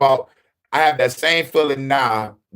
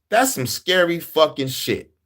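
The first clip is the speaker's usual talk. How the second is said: normal talking voice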